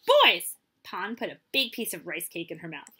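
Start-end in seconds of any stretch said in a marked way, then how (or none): 0.1-0.6 high, loud, falling call; mother crying out. A narrator's reading. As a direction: shout for the children. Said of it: "she cried".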